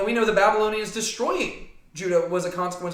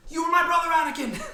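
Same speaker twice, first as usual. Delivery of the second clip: Star Wars quote; 10 semitones higher than usual. Pretend. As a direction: cry it out like a betrayed Jedi. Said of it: "I haven't even watched Star Wars lately".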